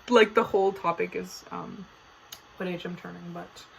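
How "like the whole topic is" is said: ordinary talk, nothing marked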